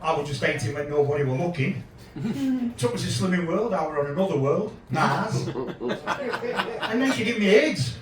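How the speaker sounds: ordinary talk, nothing marked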